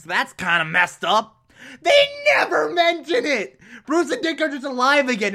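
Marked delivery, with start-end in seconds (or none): 1.8-5.3 high-pitched incredulous complaint; outraged rant. Real, not acted. Reviewer ranting about Bruce and Dick being alive, exasperated outrage. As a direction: wail it in comic exasperation.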